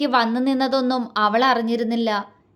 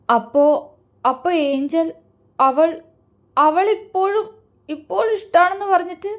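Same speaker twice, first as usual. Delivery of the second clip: higher, breaking, tearful voice; sobbing question; 5 semitones higher than usual. A narrator's reading. Aishu asking Mithu about Angel: tearful sobbing. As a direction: choke the words out through sobs, rising up. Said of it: "അവൾ തേങ്ങിക്കൊണ്ട് ചോദിച്ചു".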